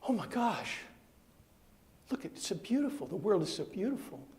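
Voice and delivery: high, soft wonder; awestruck Eve. Acted. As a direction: marvel at a newly seen world. Eve awed after eating apple.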